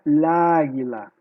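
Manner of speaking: "la águila" with the two a's fused into one long sound; "la águila" slurred together with no gap, loudly; words run together. Shown as a demonstration. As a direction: blur "la" into "águila". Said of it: "Um som emenda no outro".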